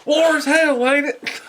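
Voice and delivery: high-pitched hammed-up punchline; movie soldier. Finishing a Full Metal Jacket quote, soldier voice. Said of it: "fucking Full Metal Jacket".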